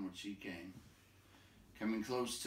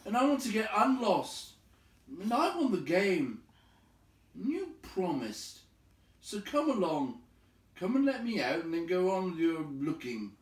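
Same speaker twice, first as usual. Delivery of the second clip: insistent; 9 semitones higher, 7 dB louder than usual. Acted. Bilbo demands his way out.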